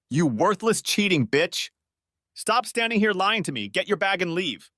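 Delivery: husband snapping at his lying wife; snapping in rage. Acted. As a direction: spit the insult at her. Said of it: "That's when I snapped".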